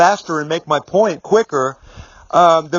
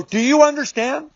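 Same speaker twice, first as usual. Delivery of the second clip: firm, higher-pitched challenge without yelling; 9 semitones higher than usual. Retold or imitated. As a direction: press the question firmly, no shouting. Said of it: "but I was very firm".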